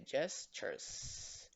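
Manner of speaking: "gestures" in two slow parts, ges... tures, last E mute; silent E. Shown as a demonstration. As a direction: sound out "gestures" slowly with no vowel before the final s. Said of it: "la E también es muda, silenciosa". ("gestures" despacio, con la e final muda)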